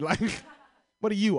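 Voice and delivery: normal talking voice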